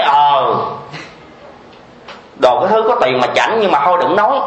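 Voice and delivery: mock disdainful mutter; scornful retort. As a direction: scoff at the rich woman. Voices the listening woman's retort.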